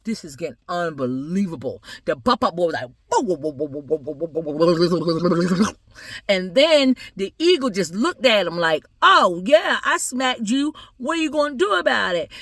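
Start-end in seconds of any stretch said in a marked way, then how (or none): 3.1-5.7 rapid warbling string of whoas; dazed wobbling whoas. Acted. Little boy smacked by the eagle, reeling wobbly whoas. 9.0-12.3 high, cocky challenge; taunting eagle. Acted. Eagle challenging the boy it smacked, high and taunting.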